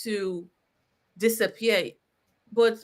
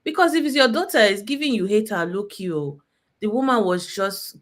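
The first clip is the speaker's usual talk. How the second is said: ordinary talk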